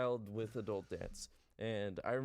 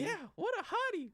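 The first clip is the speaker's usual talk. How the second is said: put-on way higher, young boy's squeaky delight; high kid voice; 3 dB louder than usual. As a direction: pitch it up like an excited little kid. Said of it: "except I said".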